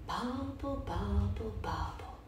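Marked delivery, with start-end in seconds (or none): none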